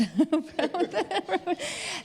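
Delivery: a bright, high burst of real laughter. Real, not acted. Panelist laughing that most of it was edited out, real laugh. Laughs about edits being cut.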